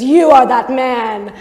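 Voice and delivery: loud, slow, weighty pronouncement; prophet's booming reveal, acted; dramatic accusation. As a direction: land each word as an accusation. Nathan accuses David.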